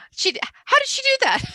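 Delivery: sudden high squeaky mock astonishment; amazed disbelief. Author marvels at the narrator getting the word right, amazed. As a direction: squeal it as if you can't believe she managed it.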